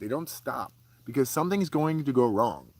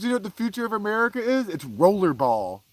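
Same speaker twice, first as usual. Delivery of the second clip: imitating dad; 6 semitones higher, 3 dB louder than usual. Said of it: "he goes, he goes".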